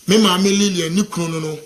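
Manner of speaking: ordinary delivery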